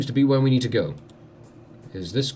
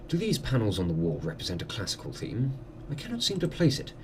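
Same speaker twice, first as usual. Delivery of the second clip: softer, politely curious gentleman; visiting doctor; 3 semitones lower, 7 dB quieter than usual. Acted. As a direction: ask formally, as a curious guest.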